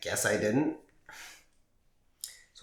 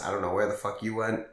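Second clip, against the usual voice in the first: ordinary talk, nothing marked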